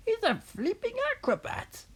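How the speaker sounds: very high screechy Grandma voice; rooftop shout. A narrator's reading. Grandma calling from the rooftop, very high mock screech. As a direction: screech it as an old granny. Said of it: "Grandma shouted from the rooftop".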